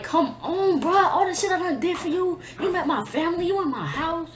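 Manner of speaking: high-pitched, hurt pleading; retold tearful plea. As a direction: plead as if crying, without real sobs. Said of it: "I'm crying my eyes out".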